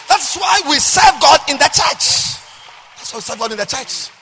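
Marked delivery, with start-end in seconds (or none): 0.1-2.4 loud, high, slow preached cry; shouted refrain. Acted. Pastor shouting the refrain to congregation. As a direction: belt it out to the pews.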